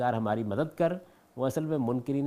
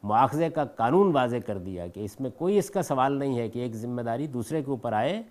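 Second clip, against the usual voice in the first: normal talking voice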